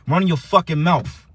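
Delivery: normal talking voice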